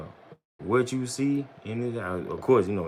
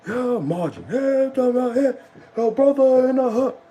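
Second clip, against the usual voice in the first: loud, very high mock yelling; mimicked protest shout; 9 dB louder than usual. Streamer imitating protesters out there marching, high mocking shout.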